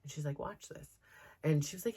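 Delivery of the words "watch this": whispered aside. Retold or imitated. Teacher quietly telling mother to watch, whispered.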